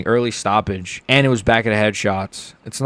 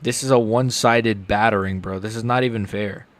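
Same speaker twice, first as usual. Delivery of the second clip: usual speaking voice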